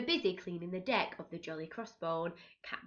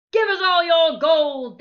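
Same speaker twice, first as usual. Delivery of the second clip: very loud, higher threat; pirate shout; 8 semitones higher, 13 dB louder than usual. A narrator's reading. Nasty pirates demand gold, very loud shout. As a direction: yell it with cutlasses waving. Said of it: "they cried".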